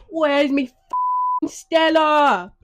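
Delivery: loud, high English football-lad shout, slowed on the match; Gammon accent. Shown as a demonstration. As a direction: bellow it like a pub hooligan at the game. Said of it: "the Gammon accent".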